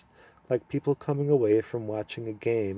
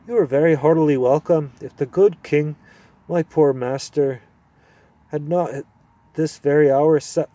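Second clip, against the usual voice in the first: put-on kindly old dwarf; 4 semitones higher, 4 dB louder than usual. Old dwarf welcoming the children. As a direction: greet them warmly like an old lord.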